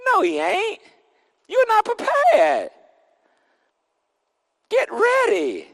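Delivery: high, slow, ringing climax; high preaching cry. Acted. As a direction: lift it high and drag it out.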